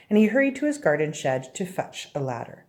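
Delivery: ordinary delivery, nothing marked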